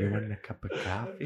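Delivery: soft, hushed nonna whisper; whispering grandma. Acted. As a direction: whisper it right into the ear. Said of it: "why is she whispering in your ear?".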